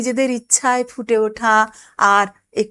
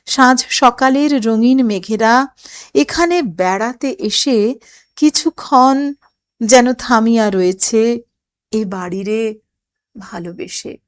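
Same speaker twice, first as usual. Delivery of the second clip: poem recitation; 5 dB louder than usual. Acted. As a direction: savour each line like a recital. Teacher recites poem lines.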